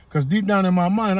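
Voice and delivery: ordinary delivery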